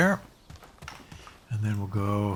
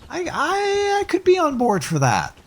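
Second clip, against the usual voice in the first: squeaky high voice. Pretend. Jokey reluctant agreement.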